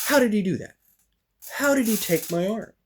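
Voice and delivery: high-pitched, baffled exasperation; acted-out indignant bewilderment; retold angry disbelief. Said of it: "I'm mad. I'm getting mad".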